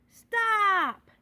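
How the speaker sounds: a sudden loud, high cry of Stop; shouting. A narrator's reading. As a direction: yell it out to make them all freeze. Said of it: "shouted Little Frog".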